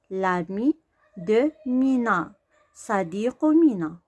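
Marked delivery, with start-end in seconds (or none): 0.1-2.3 slow reading. Shown as a demonstration. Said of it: "لامي هو الصديق".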